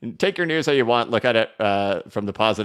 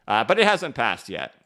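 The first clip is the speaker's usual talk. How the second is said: ordinary talk, nothing marked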